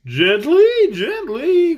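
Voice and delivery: soothing caution, rising high; calm them down, gently. Acted. Scarecrow calming the angry tin soldier, gentle caution. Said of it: "cautioned the Scarecrow".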